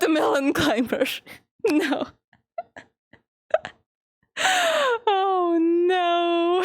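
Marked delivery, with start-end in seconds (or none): 4.4-5.0 high squealing laugh; squeal of laughter. Real, not acted. Streamer cracking up at a pun, high squeal. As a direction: burst into a shrieking laugh.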